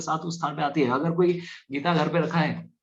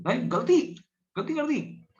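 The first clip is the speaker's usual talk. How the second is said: much higher, dismayed cry; dismayed realisation; 10 semitones higher than usual. Retold or imitated. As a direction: gasp at the blunder. Yamraj realising the mistake. (Yamraj की ऊँची, घबराई आवाज़)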